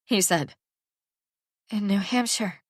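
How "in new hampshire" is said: barely managed. Acted. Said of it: "Anna managed".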